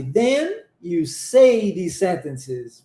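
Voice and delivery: slow, careful English model reading; model English sentence. Shown as a demonstration. Teacher modeling the sentence slowly for repetition. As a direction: read it out slowly for the students to copy. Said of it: "vamos repetir essa primeira frase".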